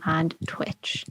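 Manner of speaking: soft breathy whisper tacked on; whispered aside. Real, not acted. Host listing his socials whispers the final one. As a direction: drop to a whisper for the last word.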